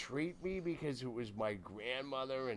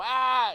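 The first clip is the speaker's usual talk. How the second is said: short, high, loud yell; startled acted shriek; re-enacted scream; 12 semitones higher, 12 dB louder than usual. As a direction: scream back in mock horror. Re-enacting his answering scream.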